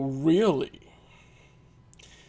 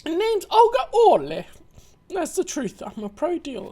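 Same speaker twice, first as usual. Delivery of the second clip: put-on higher, bolder Russian accent; confident Russian accent; 10 semitones higher than usual. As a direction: lift the pitch and own the Russian accent. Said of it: "raises it up a bit".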